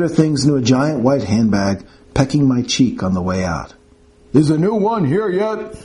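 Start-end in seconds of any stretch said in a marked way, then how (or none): 4.3-5.7 put-on raised old man's voice calling out; yelled from another room. Grumpy patient yelling for the new nurse. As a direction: call out impatiently from the bedroom. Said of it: "a voice yelled from inside the house".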